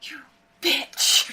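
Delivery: sharp angry curse; angry outburst. Acted. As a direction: spit the word out in anger. Player character outburst at insult.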